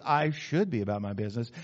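low, lukewarm admission; half-hearted. Shown as a demonstration. Preacher showing weak resolve, low half-hearted admission. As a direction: say it without conviction. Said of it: "Or maybe it's".

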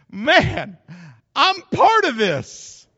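high, loud delighted cry of a patriarch; Jacob rejoicing. Retold or imitated. Jacob in heaven, thrilled at his lineage, loud and high. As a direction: crow it out like a proud ancestor in heaven. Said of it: "and he's going".

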